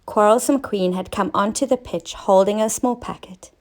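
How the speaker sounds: usual speaking voice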